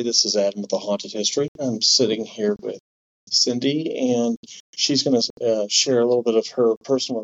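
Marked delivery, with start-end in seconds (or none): none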